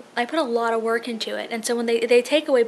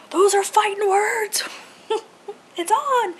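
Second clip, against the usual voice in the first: whispered high mock threat, slow; mock tough challenge; 10 semitones higher, 4 dB louder, slower than usual. Vlogger imagining challenging the cable company, mock tough. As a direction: ham up a playful showdown. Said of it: "been like".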